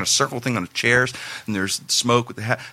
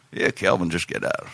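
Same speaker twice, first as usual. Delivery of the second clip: put-on lower, mumbly old uncle voice; Uncle Smoke impression; 7 semitones lower than usual. Uncle explaining his son's release, lower mock uncle voice. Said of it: "Pretty good Uncle Smoke impression".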